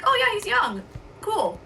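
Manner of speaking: lightly upbeat, an easy passing remark; cheerful, no offence. Retold or imitated. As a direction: say it breezily like a friendly aside. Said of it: "I was like".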